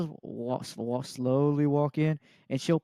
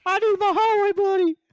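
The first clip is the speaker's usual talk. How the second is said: high squeaky voice; 6 dB louder than usual. Retold or imitated. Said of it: "Kyle's just like".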